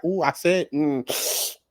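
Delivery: normal talking voice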